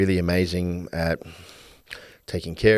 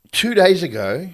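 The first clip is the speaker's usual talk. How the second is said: loud, higher, incredulous protest; exasperated retort; 5 semitones higher, 5 dB louder than usual. Retold or imitated. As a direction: argue back in disbelief. Man retorting to his partner.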